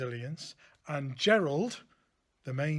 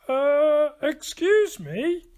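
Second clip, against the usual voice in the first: high, loud, slow polite little voice; cricket coughing politely; 12 semitones higher, 11 dB louder, much slower than usual. Acted. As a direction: clear your throat and interrupt. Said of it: "coughed a cricket".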